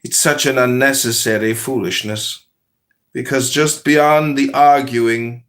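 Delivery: calm, measured parable reading; unhurried storyteller voice; story narration. Acted. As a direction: tell it like a wise fable. Reads a spiritual parable aloud.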